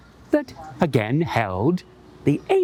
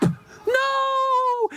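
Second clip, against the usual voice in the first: terrified cry. Acted. Little Rabbit screaming at the hungry Fox. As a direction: shriek as the fox lunges.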